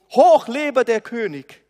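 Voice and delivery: loud jubilant cheer. Acted. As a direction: hail the king joyfully. Crowd cheers the king.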